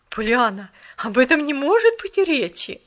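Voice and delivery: loud, stern final no; stern lady, loud; firm refusal. A narrator's reading. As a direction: mistress shutting it down. Lady's flat refusal.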